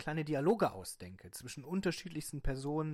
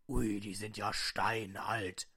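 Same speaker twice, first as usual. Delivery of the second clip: low slow voice; 4 semitones lower, 3 dB louder, much slower than usual. Acted. Appraiser voice.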